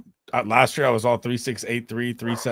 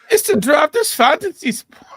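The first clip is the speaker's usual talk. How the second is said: high, loud fake German shout; mock German accent; 10 dB louder than usual. Co-host barges into the promo, mock German accent yell.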